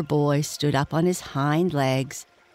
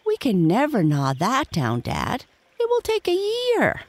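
put-on protesting child, leaping very high; dismayed cry; 9 semitones higher than usual. A narrator's reading. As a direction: balk at the impossible job. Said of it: "cried Toto".

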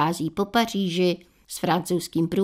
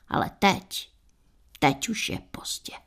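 whisper; 6 dB quieter than usual. Retold or imitated. Cosmetics friend's grave verdict.

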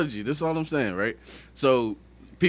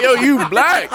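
laughing through the line; laughing quote; 8 semitones higher, 4 dB louder, slower than usual. Retold or imitated. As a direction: laugh while quoting yourself. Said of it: "I'm like".

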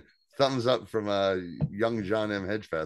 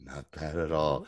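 low, quieter pleased aside; imitated smug approval; 6 semitones lower, 4 dB quieter than usual. Repeating the favourite line after a bite, low imitated approval. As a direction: drop low, as if to yourself. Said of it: "my favorite line delivery".

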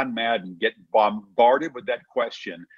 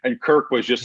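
ordinary talk, nothing marked

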